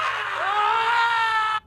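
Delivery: long, high, loud scream; mock labour scream. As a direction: scream through a pretend delivery. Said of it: "I'm screaming!".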